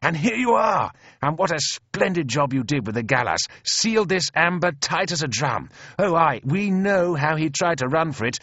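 high, effusive congratulation; beaming. A narrator's reading. Officer pumping his friend's hand in praise, beaming.

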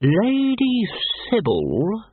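high, drawn-out lawyer's drone; high-pitched legal droning. A narrator's reading. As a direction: stretch it out like a tedious solicitor. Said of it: "said the lawyer".

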